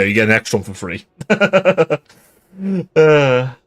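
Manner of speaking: usual speaking voice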